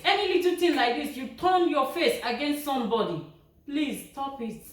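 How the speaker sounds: ordinary talk, nothing marked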